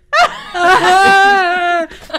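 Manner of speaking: high, loud helpless laughter; loud laughing fit. Real, not acted. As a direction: burst out in a real cackle. Cracking up over slip of tongue.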